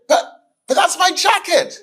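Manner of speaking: very high, pleading protest. Retold or imitated. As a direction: squeak out the complaint in disbelief. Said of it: "They looked at him and said".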